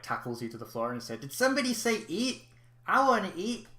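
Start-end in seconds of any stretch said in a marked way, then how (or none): 1.2-3.7 much higher, eager little monster begging for food; a hungry pup who just heard the word eat; eager Gabumon creature voice. Acted.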